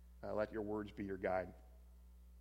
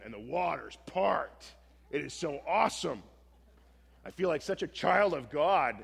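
rising, louder, thrilled wonder; excited awe; 5 semitones higher, 9 dB louder than usual. Retold or imitated. Preacher reliving Moses parting the sea, thrilled and loud. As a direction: swept up in the miracle, voice lifting.

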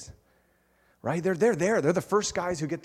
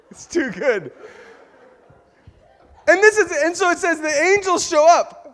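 normal talking voice

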